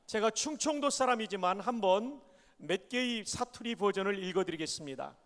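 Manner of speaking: ordinary talk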